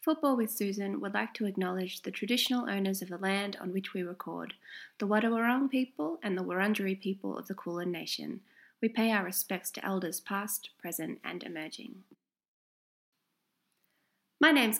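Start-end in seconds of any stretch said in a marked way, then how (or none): 0.0-12.0 soft, solemn formal reading; formal acknowledgement. Real, not acted. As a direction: read it quietly and respectfully. Reads acknowledgement of country.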